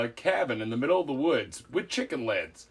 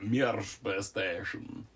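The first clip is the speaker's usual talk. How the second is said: lower, slow Russian man's accent; a lightly done Russian guy voice, words dragged; half-joking Russian accent, low and slow; 8 semitones lower, 8 dB quieter, much slower than usual. Shown as a demonstration. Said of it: "I can do a guy Russian voice".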